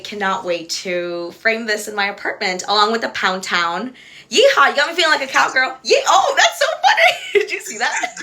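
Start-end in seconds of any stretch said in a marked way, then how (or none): 4.3-5.8 quick, bright-voiced cowgirl whoop; fast and higher, giddy yeehaw; excited whoop. Real, not acted. Thrilled with her cute comic. 5.8-8.2 much higher through real laughter; laughing. Real, not acted. Laughing at a funny moment.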